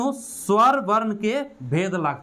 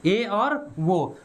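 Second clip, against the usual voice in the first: drawn out; much slower than usual. Shown as a demonstration. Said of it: "एकर example में की होते ए और ओ".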